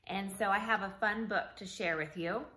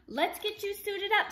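much higher, bright; cheery official; 11 semitones higher than usual. Acted. Official readies Jake for launch, high cheery voice. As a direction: sing it out cheerfully.

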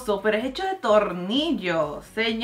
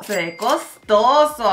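drawn-out, savoring costoso; slow admiring; 5 dB louder, slower than usual. Acted. Admiring the fancy bathroom, drawn out. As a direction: slowly admire the luxury.

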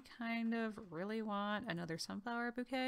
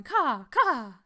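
loud, higher mock raven caw; raven impression; 3 semitones higher, 10 dB louder than usual. Player imitates the raven for viewers, loud raven cawing. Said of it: "That was my best raven impression".

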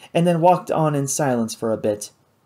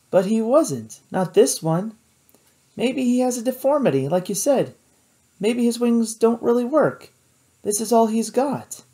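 lightly voiced young woman, higher; higher, earnest girl's voice; 9 semitones higher than usual. A narrator's reading. Kate voiced in story.

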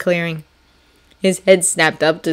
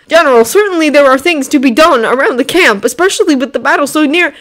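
put-on higher, louder eager young officer; eager Frenchman; 9 semitones higher, 7 dB louder than usual. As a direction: plead keenly as a French officer. Lafayette voiced in story.

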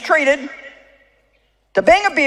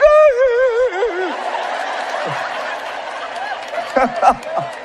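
mock kids laughing high; high giggling burst; high squealing laugh; 10 semitones higher, 3 dB quieter than usual. Kids come in like.

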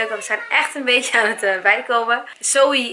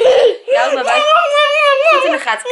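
laughing; 13 semitones higher, 5 dB louder than usual. Real, not acted. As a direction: laugh while speaking. Laughing at child.

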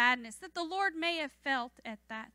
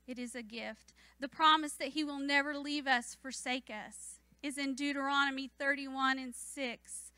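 usual speaking voice

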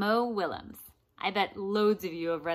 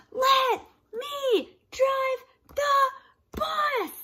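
crying shout, much higher and louder; pigeon tantrum; 7 dB louder, much slower than usual. Acted. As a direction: scream it in a wailing meltdown.

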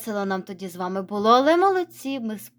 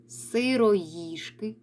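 very slow, drawn-out Сироїжки; slow reading; 4 dB quieter, much slower than usual. Shown as a demonstration. Teacher modelling a word for pupils, very slow. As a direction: spell out Сироїжки slowly in sound. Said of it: "Читайте далі самостійно".

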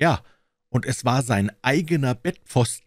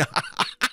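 short acted burst of delighted laughter; hearty laugh. A narrator's reading. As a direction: laugh out in overjoyed relief. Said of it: "ein vortreffliches Lachen". (herzhaftes, gespieltes Auflachen)

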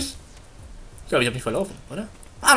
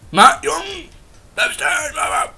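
frustrated chase shout; 10 semitones higher, 7 dB louder, slower than usual. Real, not acted. Chasing a fleeing enemy.

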